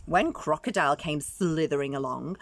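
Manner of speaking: ordinary delivery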